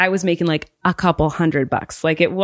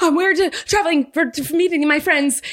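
much higher flustered stumbling answer; panicked; 10 semitones higher than usual. Retold or imitated. Performer answering French customs, high and flustered. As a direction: trip over the words in panic. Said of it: "I'm, like, a panicked person".

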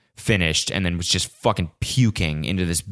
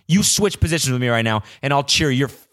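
higher, taunting dare; mock challenge; 5 semitones higher than usual. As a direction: dare the fan to swap places. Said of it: "those were my inner monologues".

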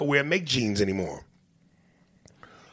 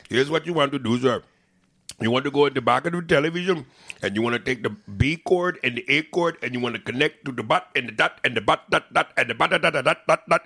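fast, higher Indian tech-support patter; Indian accent; 3 semitones higher than usual. Retold or imitated. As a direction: rattle off instructions in a mock Indian accent. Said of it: "on the phone with the Indian guy".

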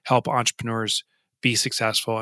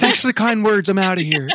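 laughing joke exit; 9 semitones higher, 6 dB louder than usual. Pretend. Joking exit.

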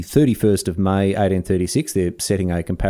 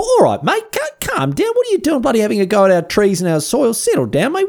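much higher, huffy "calm down, mate"; mock offended; 12 semitones higher than usual. Host telling Darwin to settle down, hammed-up offence and high. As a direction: tell Darwin off in hammed-up outrage. Mock defending Aussie trees and soil.

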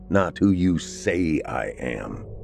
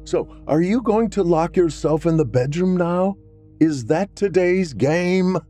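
calling out; 12 semitones higher than usual. Acted. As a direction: taunt her from across the house. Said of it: "he called to her".

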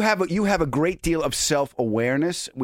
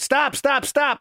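much higher, urgent repeated call; retold calling out; 11 semitones higher, slower than usual. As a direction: stop the driver in a hurry. Calling the cab to halt.

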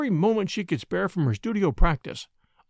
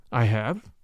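grave; 6 semitones lower than usual. A narrator's reading. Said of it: "said the professor gravely".

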